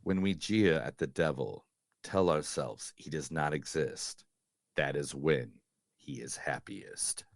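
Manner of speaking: low, slow, grave movie-quote read; low ominous priest voice. Acted. Host reading a horror film quote, low and ominous. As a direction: intone it like a brooding exorcist.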